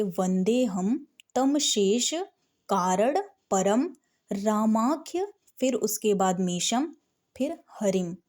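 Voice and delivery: "वंदे हम तम शेष" read slowly phrase by phrase with pauses; slow, broken up. Shown as a demonstration. Teacher to beginner students, reading each piece slowly. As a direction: break the verse into small pieces for a beginner. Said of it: "तो आप धीरे धीरे पढ़ेंगे".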